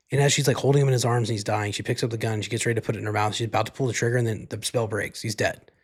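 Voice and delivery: normal talking voice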